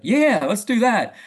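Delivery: loud, slow juvenile cheer; juvenile enthusiasm. Retold or imitated. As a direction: imitate an overgrown kid loving the idea. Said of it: "it sounds juvenile".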